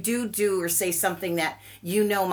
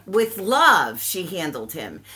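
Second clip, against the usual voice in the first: ordinary talk, nothing marked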